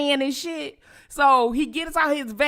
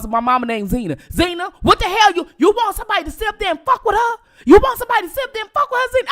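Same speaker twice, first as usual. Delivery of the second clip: loud high scolding as the uncle; high shouted uncle rant; 10 semitones higher, 5 dB louder, much faster than usual. Retold or imitated. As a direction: uncle tearing into mama, rising and fast. Uncle scolding mama Zena.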